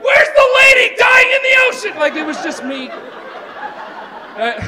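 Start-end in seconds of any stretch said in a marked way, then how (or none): 0.0-1.9 high, loud alarmed call; alarmed onlookers. Retold or imitated. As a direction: shout it like worried beachgoers. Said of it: "people on the beach are like".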